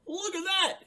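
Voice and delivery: high, bright puppet voice for Ernie; excited high Ernie calling out. Acted. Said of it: "Ernie stopped".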